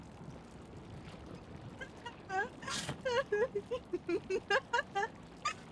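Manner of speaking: high, wheezing laughing fit; real uncontrollable laughter; helpless laughter. Real, not acted. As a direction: laugh until the voice gives out. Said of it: "I'm in tears".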